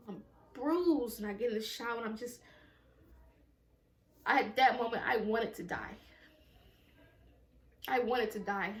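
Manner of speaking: usual speaking voice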